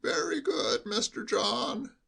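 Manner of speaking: high, slow, quavering sheep-like bleat; an old servant bleating every word; bleating old butler. Acted. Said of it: "bleated like a sheep".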